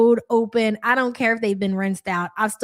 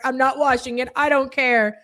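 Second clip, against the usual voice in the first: mock stubborn; 3 semitones higher than usual. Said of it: "And you're like".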